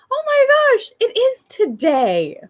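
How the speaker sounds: high, gushing reply; amazed delight. Retold or imitated. Mom answering her little girl at dawn, amazed. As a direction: marvel along with an excited child, drawing it out.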